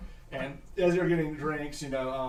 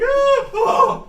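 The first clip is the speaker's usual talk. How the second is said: fainting cry; 14 dB louder than usual. Acted.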